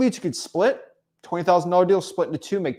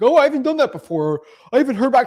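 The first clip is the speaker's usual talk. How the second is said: higher, louder whiny mock complaint; mocking whiny excuse; 8 semitones higher, 6 dB louder than usual. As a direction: ham up a lazy student's excuse. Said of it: "And they're like".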